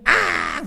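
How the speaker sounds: sudden loud, high mock bawl; imitated child's wail. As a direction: burst out crying like a toddler, acted. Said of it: "애가 터져버린 거예요".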